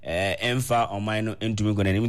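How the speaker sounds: ordinary delivery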